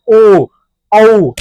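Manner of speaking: ओ and औ each held long; throat-lip vowel demo. Shown as a demonstration. Teacher showing throat and lips together, drawn-out ओ, औ. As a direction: sound ओ, then औ separately with rounded lips. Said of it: "कंठ और ओष्ठ दोनों मिलकर".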